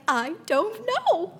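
put-on high, slow little-girl "I don't know" through a laugh; toddler voice. Mother imitates her toddler daughter answering: high, slow, giggly. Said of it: "She's like".

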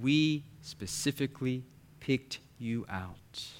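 each word of "We specifically picked you out" stressed slowly; slow, deliberate emphasis. Retold or imitated. Parents reassuring their adopted son, slow emphasis on every word. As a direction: set "We", "specifically", "picked", "you", "out" apart with weight. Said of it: "repeated it slowly for me".

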